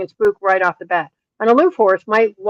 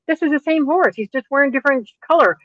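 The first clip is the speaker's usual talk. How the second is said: higher-pitched, mildly incredulous protest; retold exasperation; 6 semitones higher than usual. Trainer to friend who bought the same horse, mildly exasperated. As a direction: retell your disbelief, pitched up.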